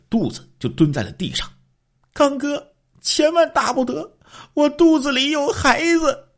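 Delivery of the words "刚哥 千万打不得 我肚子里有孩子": high-pitched pleading woman's voice; the beaten wife begs her husband to spare the child, high and pleading; frightened wife. A narrator's reading. Wife begs after being hit.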